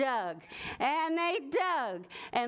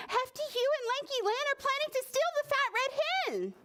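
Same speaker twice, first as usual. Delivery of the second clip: high, fast, tiny ladybug warning; 14 semitones higher, much faster than usual. Acted. Ladybug warning the farm animals, high and fast. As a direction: squeak it out quickly like an alarmed little bug.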